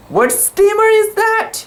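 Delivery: high, piercing scream across the water; shrill hail in the dark. A narrator's reading. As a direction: scream the question at the ship. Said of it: "screamed Mahan".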